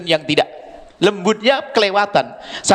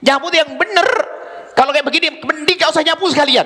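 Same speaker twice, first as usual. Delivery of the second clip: mock scolding mother; 10 semitones higher than usual. Mother scolding child for sloppy sweeping, mock angry.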